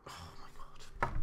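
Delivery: breathless low whisper of horror; whispered dread. Real, not acted. Gamer whispering in horror as his team concedes. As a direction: breathe it out in shock.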